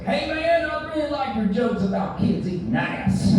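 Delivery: high mock yell of a passing fan; yelled from a car. Comic imitates a fan yelling from a car, high mock yell. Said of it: "yelled out at me as I walked".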